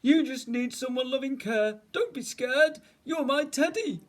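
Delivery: high, loud, doting voice; coaxing bear. A narrator's reading. Bear coaxes the frightened voice.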